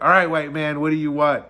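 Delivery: imitating the vendor's joking jab; funny teasing tone. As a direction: tease the customer like an old friend. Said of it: "she said it in a funny way".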